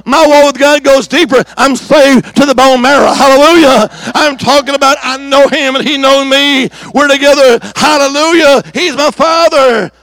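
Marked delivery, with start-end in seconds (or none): none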